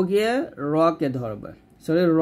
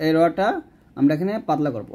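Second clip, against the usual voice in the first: normal talking voice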